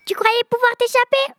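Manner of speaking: high special pixie voice; a sly little elf with a secret. Acted. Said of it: "j'ai choisi une voix spéciale".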